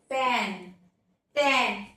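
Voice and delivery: पेन, टेन low with a short half-open e. Shown as a demonstration. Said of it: "थोड़ा सा उससे मुंह थोड़ा कम खुलेगा".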